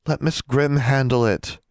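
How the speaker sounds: high-pitched entreaty; begging. A narrator's reading. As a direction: beg her, drawing the words out. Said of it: "Anna begged".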